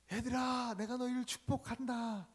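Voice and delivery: put-on boy shouting a blessing from the mountain; shouted blessing. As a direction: call the blessing across the valley. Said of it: "산에서 고함을 치며".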